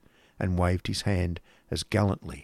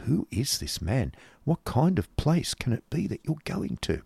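put-on soft, lilting young woman's voice; anxious sister; 5 semitones higher, 5 dB quieter than usual. Sister asking brother about his destination, anxious. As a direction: ask softly, rising at the end. Kate quietly questions her brother.

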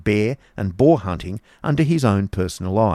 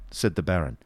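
usual speaking voice

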